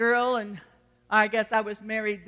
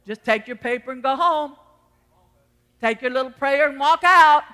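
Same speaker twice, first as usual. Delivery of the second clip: ordinary talk, nothing marked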